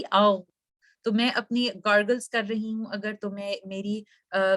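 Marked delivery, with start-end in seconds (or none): 0.0-0.4 a throaty gargling "औ"; gargle sound. Shown as a demonstration. Teacher demonstrating a gargle, throaty. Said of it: "सी".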